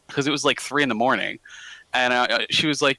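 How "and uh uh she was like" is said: normal talking voice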